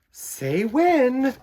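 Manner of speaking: high, loud teasing mom; mom's playful voice. Acted. Mom teasing her son at the table, playful high call. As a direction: tease the kid with a sing-song ask.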